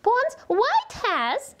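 high, bright teacher voice; kindergarten teacher voice. Acted. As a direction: lead the child into counting.

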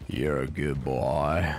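low, slow, soothing to an animal; soothing praise. Real, not acted. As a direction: draw it out gently, pitched low.

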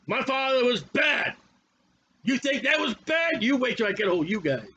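a mean king barking down at his people; angry wicked king. Acted. King Rehoboam threatening the people, in an angry shouted voice. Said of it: "So he's got an angry, mean, wicked voice".

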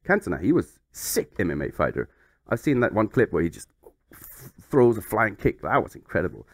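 normal talking voice

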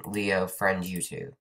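ordinary talk, nothing marked

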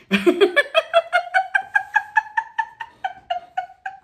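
high, loud fit of laughter; long peals of high laughter; cracking up. Real, not acted. Laughing at her own Rico Suave, cracking up.

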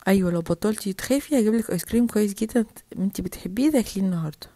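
low adult voice, coaxing; coaxing mother. Acted. Mother offers ice cream to calm daughter, coaxing. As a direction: pitch it low to persuade a child.

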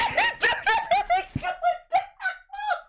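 shrill hysterical cackle; high shrieking laugh. Real, not acted. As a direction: squeal with laughter. Hysterical reaction.

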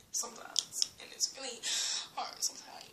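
hushed whispered complaint. Real, not acted. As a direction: let it fall to a whisper. Complaining aside.

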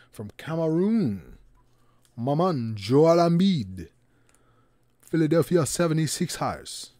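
put-on hamming up an invented Cameroonian accent; made-up Cameroonian accent. Card opener announcing Embiid's pull, mock Cameroonian accent. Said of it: "I don't know if that's the real accent".

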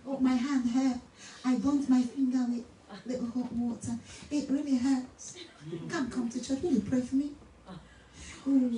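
soft, meek mock complaint; hammed-up little pleading voice; feeble excuse-maker. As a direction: imitate a delicate churchgoer making excuses. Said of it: "we want to be politically correct".

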